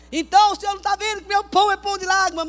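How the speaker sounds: queixa teatral, aguda e alta; cobrança dramática. Acted. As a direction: reclame com Deus em tom de melodrama. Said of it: "Eu sempre fui dramática". (súplica melodramática)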